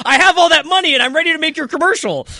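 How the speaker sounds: high, loud, frantic voice; manic Joan voice. Retold or imitated. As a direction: rattle it off like someone high on coke.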